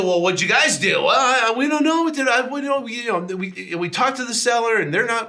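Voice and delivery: high, panicky mock stammer; mocking the team's helpless excuses in a high voice; flustered team. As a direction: squeak it out like a rattled team.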